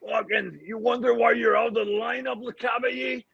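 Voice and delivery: Tortorella impression. Hockey player mimicking his coach ranting at teammates, shouting. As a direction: chew out the players as the furious coach. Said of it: "pretending I'm Torts".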